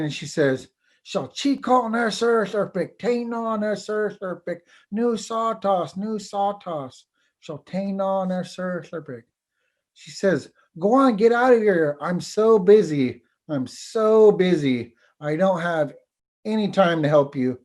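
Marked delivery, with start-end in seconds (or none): 1.0-9.2 high, hurried, impatient voice; busy impatient tree. Acted. Busy oak tree snaps at bird. 10.8-17.6 high, loud and put-upon; impatient tree voice. Acted. Oak tree shoos the bird.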